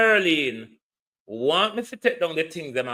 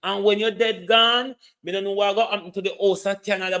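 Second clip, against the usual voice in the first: ordinary delivery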